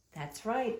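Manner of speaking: ordinary delivery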